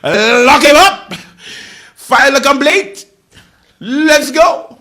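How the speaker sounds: high, loud, slow mock rally cry; hammed-up protest chant; mock outrage. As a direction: whip up a fake crowd.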